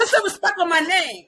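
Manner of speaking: high, loud playful demand; sassy diva demand, laughing; mock demand. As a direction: bark it out like a diva, hammed up. Friend joins playful outrage.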